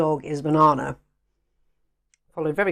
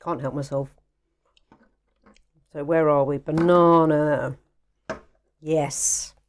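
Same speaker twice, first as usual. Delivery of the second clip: mouth full; 4 semitones lower than usual. Real, not acted. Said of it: "I will be talking with my mouth full".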